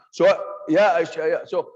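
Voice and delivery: sudden loud jolt to wake the room. Shown as a demonstration. Teacher raising voice to wake sleeping students, loud jolt. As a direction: boom it out to grab attention. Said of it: "So sometimes I deliberately raise my voice".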